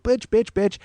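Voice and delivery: mock screaming girls. Two fighting girls being pulled apart, mock yelling. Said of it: "these girls are screaming and yelling".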